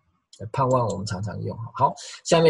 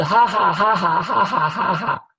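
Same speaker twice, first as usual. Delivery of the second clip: high, loud pulsing ha-ha; imitated laughing tongues; 7 semitones higher, 6 dB louder, slower than usual. Preacher sums up the pastor's tongues, loud high ha-ha. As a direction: belt out rhythmic laughing ha's in imitation. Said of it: "他的方言是".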